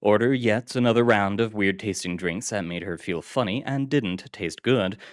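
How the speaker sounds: plain reading voice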